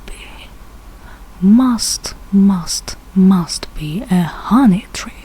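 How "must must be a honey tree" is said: usual speaking voice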